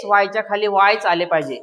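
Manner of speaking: usual speaking voice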